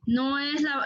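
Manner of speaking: normal talking voice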